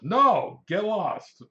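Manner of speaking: loud, high blurted brush-off; hammed-up rude dismissal; mock angry rebuff. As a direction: bark it like a customer shooing off an agent. Customer rudely rebuffs the agent.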